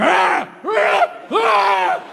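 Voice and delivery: high yelled ah ah ah, acted out; drunk yelling. Mocking a drunk, high yelled ah ah ah. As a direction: shriek it like a drunk at the bar.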